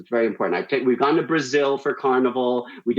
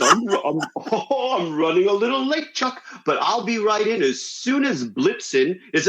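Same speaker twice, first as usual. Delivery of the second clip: higher, chuckling Santa impression; 6 semitones higher than usual. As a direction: play a jolly Santa making his excuses. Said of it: "And he goes".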